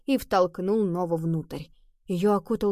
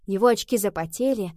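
ordinary delivery

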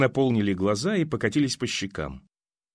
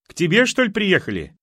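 loud, much higher shouted question; shouting; 7 semitones higher, 4 dB louder than usual. Acted. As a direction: yell it across the yard. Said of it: "кричала Валюшка".

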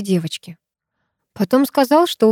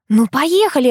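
high, eager nudge; lightly pleading invitation; coaxing; 7 semitones higher than usual. A narrator's reading. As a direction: urge a friend to come along. Coaxing friend to come.

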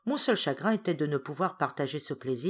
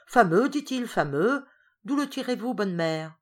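much higher and louder, delighted; satisfied exclaim; 5 semitones higher, 5 dB louder than usual. A narrator's reading. Hippel praising the wine to the old woman, delighted. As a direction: smack the lips over the wine. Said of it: "d'un air satisfait".

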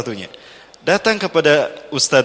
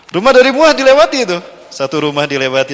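normal talking voice

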